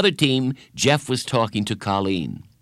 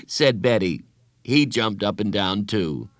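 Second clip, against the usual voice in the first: usual speaking voice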